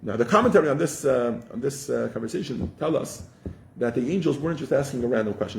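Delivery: ordinary talk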